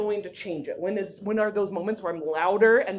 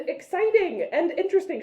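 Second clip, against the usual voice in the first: exciting and interesting; 9 semitones higher than usual. Shown as a demonstration. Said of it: "the idea is to actually sound".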